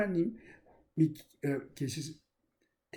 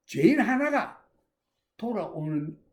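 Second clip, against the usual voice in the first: usual speaking voice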